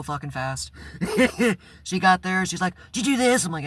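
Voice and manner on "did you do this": shrill imitation of the furious boss, much higher and louder; mock angry boss. The boss confronting him at the store, mock angry and high. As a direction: demand it like an outraged manager.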